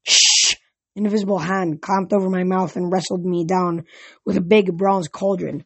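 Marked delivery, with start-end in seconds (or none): 0.0-0.5 loud hushing hiss; urgent "shh" to silence someone; forceful unvoiced shush; sharp shush. A narrator's reading. Friend hushes him.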